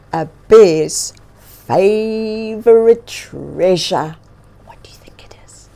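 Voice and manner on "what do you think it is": fast, secret whispered question. Acted. Reader asking children in a secret whisper. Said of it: "question to children".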